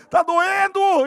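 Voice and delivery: high, loud cry that it hurts; pained yell. Retold or imitated. Patient yells at the therapist that it hurts. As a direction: yell it out as the pain peaks. Third pain plea, therapist ignores.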